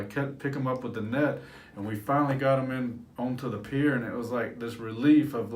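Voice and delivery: usual speaking voice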